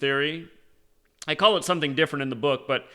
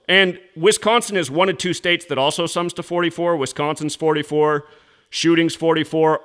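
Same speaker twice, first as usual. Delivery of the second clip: louder, fast, heated lecture down the phone; loud rant; 6 dB louder than usual. Real, not acted. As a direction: hammer every point at the governor's aide like an accusation. Ranting at the governor's office on phone.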